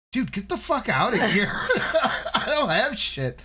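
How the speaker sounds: high, incredulous rebuff through laughter; laughing mock outburst. Retelling how he sent off a homeless man, incredulous and laughing. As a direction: push the pitch up, laughing as you protest.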